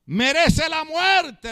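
high, drawn-out cry of condemnation; crowd's verdict. A narrator's reading. The council answers the high priest, drawn-out condemning cry. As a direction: call it out high, stretching the word.

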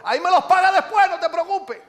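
high, loud yell from afar; retold shout. Debtor yells back to his creditor from the bus, shouting. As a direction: call back from a departing bus. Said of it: "le gritó desde la guagua".

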